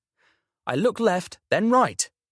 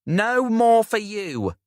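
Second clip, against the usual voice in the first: teasing; 9 semitones higher, 4 dB louder, slower than usual. A narrator's reading. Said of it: "Jerry quips".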